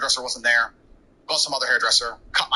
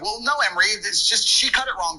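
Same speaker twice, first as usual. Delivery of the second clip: mom protesting; 7 semitones higher than usual. Retold or imitated. Mother voiced arguing back.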